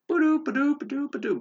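high mouth-made ba-doop rimshot; comic drum sting. Pretend. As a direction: punch the joke with a silly high drum sound. Joke sound after saying podcast isn't funny.

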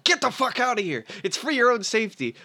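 husband snapping at his wife to get out, mock angry order; rough retold order. The husband barks at his wife to leave, mildly acted rage. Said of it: "doesn't say this in any nice way".

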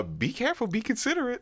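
high, soft parental voice; high-pitched mock admonishment; imitated cautioning mother. Mother warning her son before a date, imitated high and soft. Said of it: "she's saying this in front".

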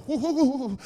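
high, loud overjoyed whoops; loud high hoots of delight; excited whooping. Retold or imitated. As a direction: whoop like a stunned groom. Groom sees bride arrive.

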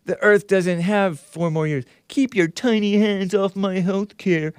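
lightly voiced mock protester. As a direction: mimic the sign-holder. Reading signs in mock voice.

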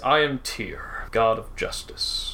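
low, slow, hushed proclamation of a god; a lightly voiced god of justice revealing himself; god Tyr's voice. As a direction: announce your divinity, quiet and grave. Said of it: "And he says".

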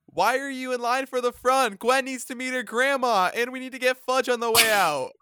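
put-on high, loud whiny teenage girl; mock a bratty fourteen-year-old; impression of teen girls. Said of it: "I'm gonna give my best impression of them".

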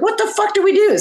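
high, incredulous retold outcry; mock dismay. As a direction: act out a baffled panic. Retold dismay at early hour.

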